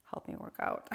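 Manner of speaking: unvoiced trailing murmur; whispered aside. Real, not acted. Streamer trailing off about working out, whispered. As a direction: drop to a whisper.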